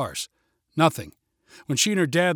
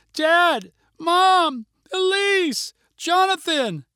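high, loud panicked calls down the slope; yelling names; 13 semitones higher, 4 dB louder, much slower than usual. A narrator's reading. Girl yelling after her family below, high and loud. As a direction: call each name out, desperate. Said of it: "She yelled again".

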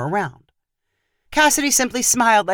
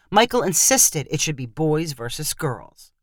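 ordinary delivery

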